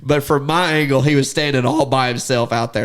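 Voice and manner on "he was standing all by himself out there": normal talking voice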